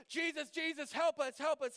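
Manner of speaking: high, urgent cry for help; acted panicky call for rescue; urgent plea. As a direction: plead like frightened disciples, voice lifted. Acting scared disciples waking Jesus.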